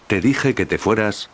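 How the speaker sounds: ordinary delivery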